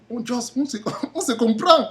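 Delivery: laughing through the words, pitched high; knowing laugh. Real, not acted. Joking with viewers who get it, laughing as they speak. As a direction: break into a high laugh mid-phrase.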